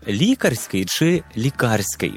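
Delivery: лікарський said twice, first stressed on "лі", then on "кар"; slow, contrasting the stress of лікарський; two stress options contrasted. Shown as a demonstration. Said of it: "Як же правильно".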